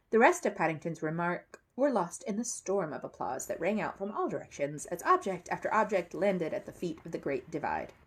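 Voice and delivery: plain reading voice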